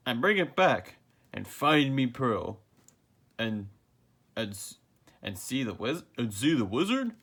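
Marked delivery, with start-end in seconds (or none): none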